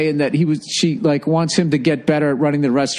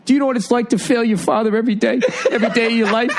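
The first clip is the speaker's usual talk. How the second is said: higher, rushed, worked-up owner voice; twisted-up upset owner; 7 semitones higher, much faster than usual. Retold or imitated. Emotional restaurant owner confronts Gordon, worked-up higher voice. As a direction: imitate an emotional man challenging the chef. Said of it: "his face gets all twisted up".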